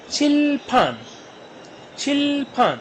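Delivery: "छिल" held long and high, then falling "फान"; syllable by syllable. Shown as a demonstration. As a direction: stretch "छिल फान" as a two-syllable model. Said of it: "फिर दूसरा syllable है फान". ("छिल फान" को खींचकर, अलग अक्षरों में)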